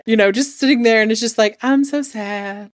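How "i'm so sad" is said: slow, mopey drawn-out moan; mock sad. Singer moping mid-concert, mock sad. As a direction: drag it out in exaggerated self-pity. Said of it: "Taylor is just like, "I'm sad".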